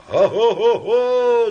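high, loud, drawn-out whooping; crowd roar. A narrator's reading. Crowd responding to the orator, roaring. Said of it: "зашумела толпа".

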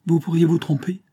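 aigre, plus haut et plus fort; riposte aigre. A narrator's reading. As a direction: lui renvoyer la pique sèchement. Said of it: "riposta aigrement Mélanie". (riposte aigre de Mélanie au vieux)

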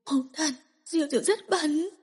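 weeping in shame; crying. Acted. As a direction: sob it out to mother. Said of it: "Cô khóc".

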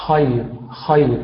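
خير begun with a hollow, breath-filled خ; isolated خير, its خ airy and voiceless; correct kha. Shown as a demonstration. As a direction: model خير with audible airflow opening the خ. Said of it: "في بداية نطقي لحرف الخاء".

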